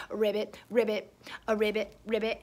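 low, soft frog croaks; frog ribbits. Pretend. Reader makes frog sounds with the children, low ribbits. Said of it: "Can you make a frog?".

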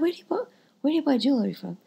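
a friend who can't believe what she hears; incredulous. Retold or imitated. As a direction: ask like it's unthinkable. Incredulous question to colleague.

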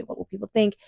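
ordinary talk, nothing marked

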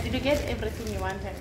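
usual speaking voice

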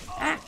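short sharp startled yelp; startled scream. Real, not acted. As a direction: jump and yelp in fright. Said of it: "You scared me".

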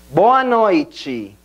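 BOA NOITE as "BOH-ah NOY-chee"; Portuguese pronunciation. Shown as a demonstration. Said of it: "backtracking con esa frasecita tan sencilla".

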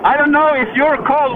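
ordinary delivery

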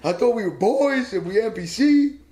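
a hint of an aggrieved old friend; mock hurt caller; indignant Cope on the phone. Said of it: "And Cope calls me".

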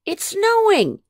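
high, loud excited yell. Acted. Pete yelling down from the burrow mouth, excited. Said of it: "Pete yelled down from the mouth".

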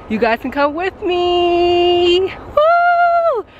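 slow, high and loud; thrilled invitation, drawn out; excited. Real, not acted.

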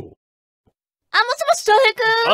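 put-on very high, slow sugary girly voice; cute phone voice. Daughter resumes her cute voice calling her date. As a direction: coo sweetly into the phone. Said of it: "ちょっと可愛い声でいくか".

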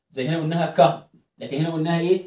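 ordinary delivery

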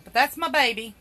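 ordinary delivery

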